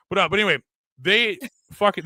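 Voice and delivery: ordinary talk, nothing marked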